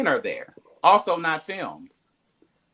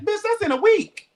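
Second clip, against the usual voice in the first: high, shocked disbelief; high shocked outburst; 3 dB louder than usual. Retold or imitated. Hearing the party is in a week, a high shocked squeal. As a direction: squeal it like you can't believe it. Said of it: "I was like".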